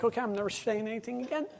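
cowed mumble. Retold or imitated. Meek after being thrown.

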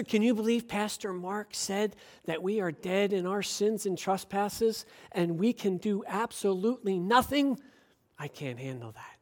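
hushed grumbling aside; mock murmur. Churchgoers grumbling about the teaching, hushed. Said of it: "This is".

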